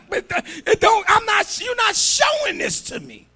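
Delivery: high, loud whining protest; hammed-up aggrieved shout; mock complaint. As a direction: cry out like someone feeling unloved. Acting out a complaining believer.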